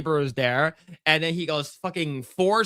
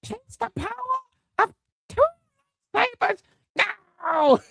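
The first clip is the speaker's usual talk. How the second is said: mock villain boast again. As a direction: squeal it like a cartoon hero. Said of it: "repeating Rey's boast".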